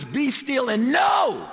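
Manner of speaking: slow, high-pitched proclamation; full-force preaching. Real, not acted. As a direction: proclaim it, stretching the last word.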